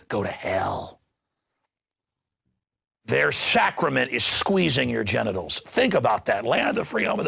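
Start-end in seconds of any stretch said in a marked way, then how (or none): 0.1-1.0 low, dismissive sneer, a bit softer; mocking the TSA's dismissal, quieter; sneering TSA reply. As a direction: brush them off with contempt. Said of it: "they said".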